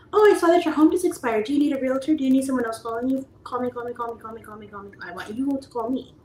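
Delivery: high, loud, over-eager sales voice; mock the desperate cold caller; pushy realtor pitch.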